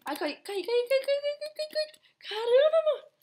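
rising high panicked wail. Real, not acted. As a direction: wail as you fall. Caindo no jogo. (lamento em pânico)

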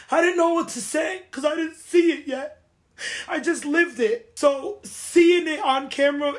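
a real upset welling up as she talks; emotional. Real, not acted. Hurt reality star venting to fans, emotional and near tears. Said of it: "I didn't mean to get emotional".

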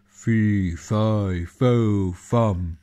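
loud, booming giant chant. Acted. The giant announcing himself in his castle, loud and booming. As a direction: boom it like a hungry giant. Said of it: "said the giant".

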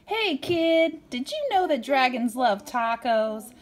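lively picture-book read-aloud; reading to children. A narrator's reading. Parent reading a storybook to a child, lively. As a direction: read it brightly to a little kid.

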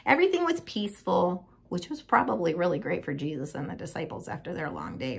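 plain reading voice

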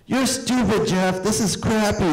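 put-on high, slow sulky boy; bratty boy calling his guest an idiot; whiny bratty kid. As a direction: whine like a spoiled child. Friend whining at guest.

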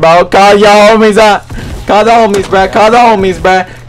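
high, repeated goading call; heated chant-like taunt, building in pitch; insistent taunting. Real, not acted. Repeated taunt at opponent in fight.

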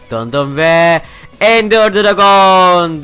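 hammed-up, slow showman reveal of the Ender Dragon; grand announcer reveal. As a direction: stretch every word like a ring announcer.

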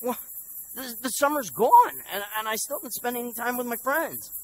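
put-on high, stumbling teenage protest; aggrieved pleading. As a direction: plead your case, voice climbing high. Acted pleading with foster father.

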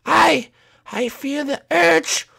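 high, slow, halting creature voice; beast building to dance. Pretend. Beast confessing an urge to dance, rising dramatically.

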